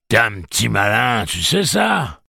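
loud, high, amused taunt; taunting villain. A narrator's reading. Pirate taunting the clever one, loud amused mockery. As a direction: crow it at the opponent.

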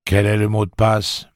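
lightly voiced droning gatekeeper; monotone drawling voice. A narrator's reading. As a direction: ask it in an unvarying drone. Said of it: "Une voix monotone et traînante".

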